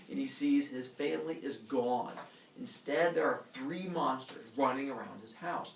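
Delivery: usual speaking voice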